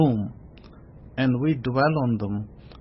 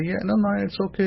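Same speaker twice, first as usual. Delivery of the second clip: higher, fast, lightly voiced hypocrite; breezy two-faced agreement; 6 semitones higher, faster than usual. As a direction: toss off a quick fake yes. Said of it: "One side you say".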